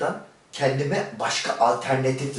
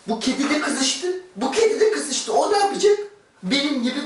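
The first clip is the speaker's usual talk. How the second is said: higher, louder worked-up squeal; high exasperated exclaim; 9 semitones higher, 4 dB louder than usual. Acted. Exclaiming at the cat, high and worked up. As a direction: squeal about the cat in heat.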